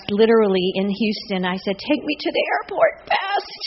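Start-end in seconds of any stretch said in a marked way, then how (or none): 1.8-3.6 high, slow, stretched-out plea to the driver; playful retold urgency; mock urgent plea. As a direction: ham up the rush to the airport. Hurried request to driver, retold.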